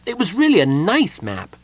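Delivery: high kender voice, apologetic; guilty. A narrator's reading. Kender consoling the gnome about his map, guilty. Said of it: "feeling guilty".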